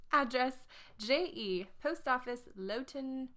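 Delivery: put-on the ad's address in a light English accent. Finishing the ad's address for listeners, English accent. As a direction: sign off the notice in a prim English accent. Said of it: "her ad was, um, in an English accent".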